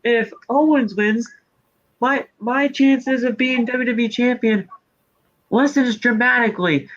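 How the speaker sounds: lightly voiced smug wrestler, pitched high; imitating Rollins. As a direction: mimic his smug commentary. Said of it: "You said throughout the entire match on commentary".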